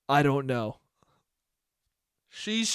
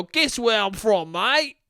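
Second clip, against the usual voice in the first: slow, lilting bad Australian accent, lifting at the end; badly faked Australian dude; muddled Australian accent; 5 semitones higher, slower than usual. Australian dude quizzing a stranger, muddled Australian accent. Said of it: "I don't even know what accent I'm doing".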